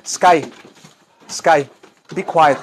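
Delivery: ordinary talk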